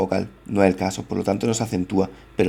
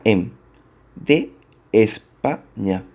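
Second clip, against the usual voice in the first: España said slowly and split as Es-pa-ña to show the count; slow it down to show each syllable of España; much slower than usual. Shown as a demonstration. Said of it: "Por ejemplo".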